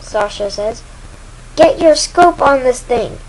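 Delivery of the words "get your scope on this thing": higher, louder cry to a partner; urgent order. Acted. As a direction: call out mid-battle. Urgent order during attack.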